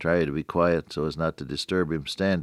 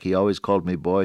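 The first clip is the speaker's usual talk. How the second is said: ordinary delivery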